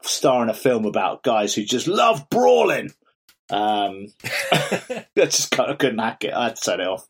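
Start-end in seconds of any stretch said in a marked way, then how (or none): 1.9-2.9 loud hammed-up Boston accent boast; half-shouted Boston accent brag; mock Boston actor. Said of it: "flex my Boston accent".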